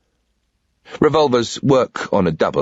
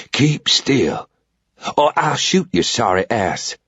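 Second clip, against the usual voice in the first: calm, slow Southern drawl, unhurried threat; lazy Southern menace, in no hurry; cool southern drawl; 3 semitones higher, slower than usual. A narrator's reading. Old Southern man warning the intruder at gunpoint, calm slow drawl. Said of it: "really cool, calm, deep southern drawl".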